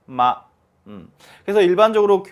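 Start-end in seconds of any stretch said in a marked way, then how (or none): 0.0-0.4 마 short and light; neutral tone. Shown as a demonstration. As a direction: toss off 마 lightly and quickly. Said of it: "짧게, 가볍게".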